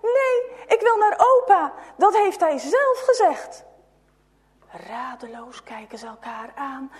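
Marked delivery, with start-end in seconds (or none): 0.0-3.6 high, loud sobbing cry; distraught child crying; crying scream. A narrator's reading. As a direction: wail it through tears. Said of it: "gilt hij".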